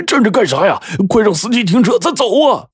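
high, panicky urging to escape; urgent shouting. A narrator's reading. Robber urging his accomplice to get off the bus, urgent. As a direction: push your partner to move, pitch soaring.

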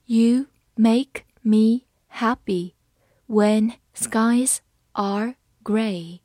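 very slow, word by word; slow reading. Shown as a demonstration. Teacher reading slowly for students, slow and clear. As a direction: read it slowly for learners. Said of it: "好，慢慢来读。".

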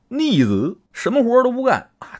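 a hammed-up angry uncle, voice jumping up; mock angry uncle. Uncle complains about nephews. (叔叔半开玩笑地气愤抱怨)

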